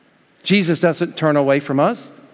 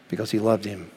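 tender; 6 semitones lower, 7 dB quieter than usual. Real, not acted. Soft answer to his own question.

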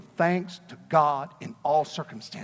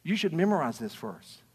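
ordinary delivery

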